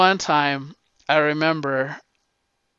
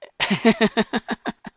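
laughing; 7 semitones higher than usual. Real, not acted. Laughing after the impression.

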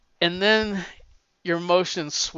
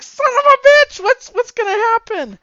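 high, very loud anguished shout; exasperated outcry; 10 dB louder than usual. Real, not acted. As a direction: howl it at the cliffhanger. Reader reacting to cliffhanger.

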